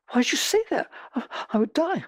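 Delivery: high-pitched, distressed protest; mock alarm. Successful person asked what if your wife left, mock alarm. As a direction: act out being horrified at the very question.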